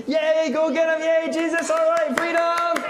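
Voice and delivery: loud, high crowd cheer; happy cheer. Shown as a demonstration. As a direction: lead an all-out cheer, stretching the end. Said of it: "Happy face, ready?".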